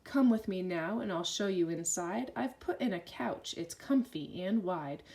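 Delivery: ordinary delivery, nothing marked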